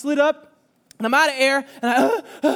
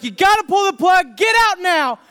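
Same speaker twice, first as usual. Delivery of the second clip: much higher, louder warning cry; urgent shout; 10 semitones higher, 5 dB louder than usual. Retold or imitated. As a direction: yell at him to get out.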